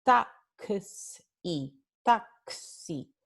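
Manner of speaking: taxi said twice, x as a clear KS; slow model of taxi with k-s; pronouncing taxi. Shown as a demonstration. Teacher repeating a model word for the class, careful KS. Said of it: "K, S".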